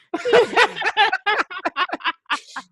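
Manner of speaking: a long high burst of real laughter. Real, not acted. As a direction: crack up laughing. Laughing at own joke.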